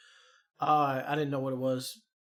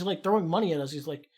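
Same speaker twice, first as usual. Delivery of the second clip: usual speaking voice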